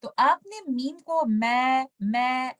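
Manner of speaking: usual speaking voice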